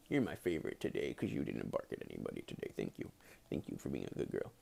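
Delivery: soft whisper to the dog; whispered, fond pet praise. Real, not acted. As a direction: hush it right down, fond.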